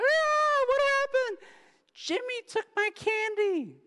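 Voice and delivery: put-on very high, loud, bawling little kid; sobbing child crying to the parent over candy; wailing child. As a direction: wail it like a kid who lost his candy. Crying kid's complaint acted out.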